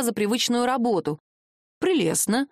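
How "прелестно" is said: plain reading voice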